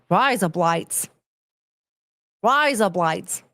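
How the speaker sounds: razor blades in Aussie, as "rise up lights"; mock Australian accent. Shown as a demonstration. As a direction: demonstrate the Australian vowels. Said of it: "that's razor blades".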